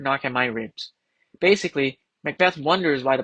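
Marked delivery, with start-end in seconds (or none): none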